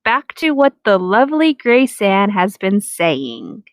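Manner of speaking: usual speaking voice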